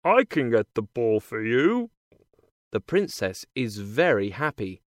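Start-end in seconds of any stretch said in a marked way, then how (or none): 0.0-1.9 lightly voiced frog character; a hint of a croaky frog. A narrator's reading. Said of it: "Then he says to her".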